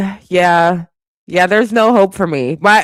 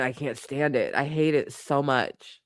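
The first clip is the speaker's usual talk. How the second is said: quiet disgust; 4 semitones lower, 13 dB quieter than usual. Real, not acted. Disgusted, much softer.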